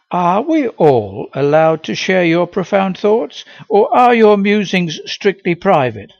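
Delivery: dry, mocking teacher question; sarcastic. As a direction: needle him with sarcasm. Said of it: "she asked sarcastically".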